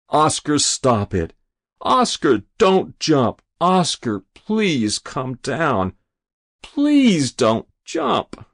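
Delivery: high, drawn-out begging; pleading. A narrator's reading. Rabbi on his knees to the boy on the roof, pleading. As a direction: beg him, stretching the words. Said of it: "he pleaded".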